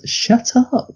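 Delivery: badly faked Regina "Shut up." with the pitch pushed up; Regina's brush-off. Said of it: "I can't do the fucking voice".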